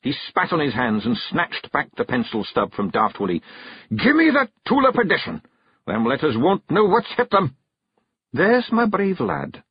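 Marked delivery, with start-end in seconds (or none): 3.9-7.6 high, loud Scots battle cry; charging the letters; defiant Feegle. Acted. Rob's voice. 8.3-9.6 high, slow Scots praise; a wife praising her man; proud Kelda. Acted. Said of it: "said Jeanie".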